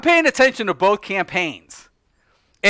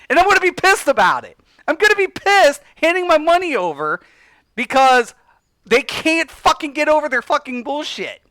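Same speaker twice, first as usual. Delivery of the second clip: angry rant; 6 semitones higher than usual. Real, not acted. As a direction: vent real frustration at the companies. Ranting about console exclusives.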